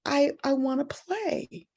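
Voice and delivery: put-on high, slow small-child voice; childlike high plea; little boy. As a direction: imitate a sad little boy. Imitates the crying boy.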